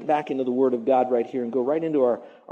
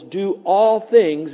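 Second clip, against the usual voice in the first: "all" held long and loud, very slow; stress on all; 4 semitones higher, 6 dB louder, much slower than usual. Shown as a demonstration. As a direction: lean hard on "all" and stretch it out. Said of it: "There's no qualifier".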